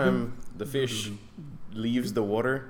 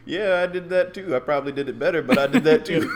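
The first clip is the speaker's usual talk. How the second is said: smug one-upping; 6 semitones higher, 6 dB louder, faster than usual. Acted. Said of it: "A lot of daggers hidden behind smiles".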